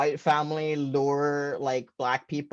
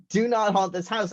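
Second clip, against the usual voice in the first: mock ghostly warning; 7 semitones higher than usual. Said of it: "What I heard was".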